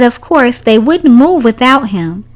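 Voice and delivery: plain reading voice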